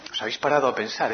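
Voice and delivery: ordinary delivery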